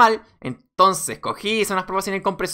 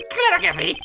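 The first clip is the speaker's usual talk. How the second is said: high-pitched willing clown voice; eager clown. Acted. Clown agrees to testify, high and eager.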